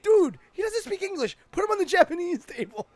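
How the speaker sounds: normal talking voice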